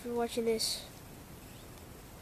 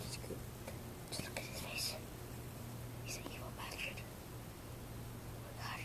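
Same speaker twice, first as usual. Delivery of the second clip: hushed mock-spooky whisper; 16 dB quieter than usual. Vlogger whispering to viewers about his evil dog, spooky whisper. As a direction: whisper it like a secret.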